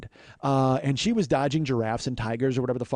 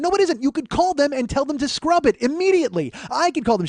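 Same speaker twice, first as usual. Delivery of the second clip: much higher, louder indignant protest; exasperated shout; 11 semitones higher, 5 dB louder than usual. Retold or imitated. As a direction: shriek your disbelief at her excuse. Arguing with the agent.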